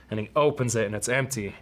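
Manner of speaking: ordinary talk, nothing marked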